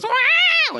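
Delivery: very high imitated cat screech; scared cat yowl. Shown as a demonstration. Preacher showing a scared cat to the congregation, shrill imitated yowl. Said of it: "when a cat is scared, it goes".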